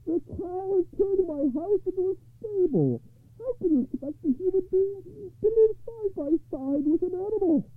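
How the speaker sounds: very high wailing complaint; high-pitched exasperated poor man; loud lament. A narrator's reading. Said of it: "lamenting loudly".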